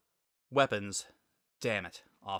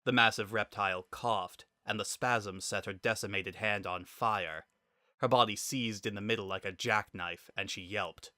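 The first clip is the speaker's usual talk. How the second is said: ordinary delivery, nothing marked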